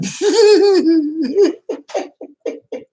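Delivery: squealing laugh, way up high; high burst of laughter. Real, not acted. Streamer cracking up at chat, high loud laugh.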